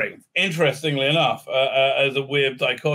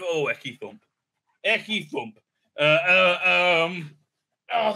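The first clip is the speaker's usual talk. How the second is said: usual speaking voice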